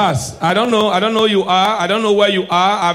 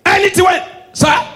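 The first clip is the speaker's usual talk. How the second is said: loud shouted punchline; 12 semitones higher, 5 dB louder than usual. Acted. Comedian to audience, loud shouted catchphrase. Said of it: "shouted punchline".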